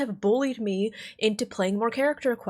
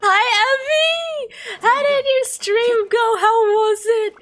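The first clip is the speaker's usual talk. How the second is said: excited; 13 semitones higher, 8 dB louder, slower than usual. Real, not acted. Greeting a raiding streamer.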